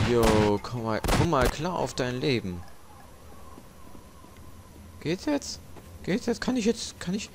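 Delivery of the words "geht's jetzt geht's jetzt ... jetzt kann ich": anxious high pleading. Real, not acted. Game frozen, anxious high asking.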